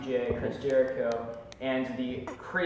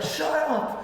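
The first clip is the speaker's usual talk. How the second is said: high, laughing mock yell. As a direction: yell it back at the crowd. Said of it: "And he's like".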